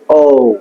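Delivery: "ও" drawn out as a gliding ou; correct O sound. Shown as a demonstration. Teacher answering how it is pronounced, stretched "ও". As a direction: hold "ও" long with a rounded glide. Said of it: "উচ্চারণটা কি হবে".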